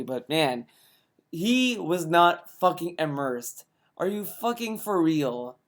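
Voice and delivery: ordinary talk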